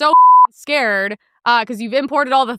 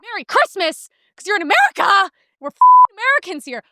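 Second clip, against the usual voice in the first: higher, rising to a loud rant; mock outburst; 7 semitones higher, 3 dB louder than usual. Comic telling off rich shoppers, higher and angry. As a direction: spit it out like a fed-up patriot. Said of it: "I actually wanted to be like".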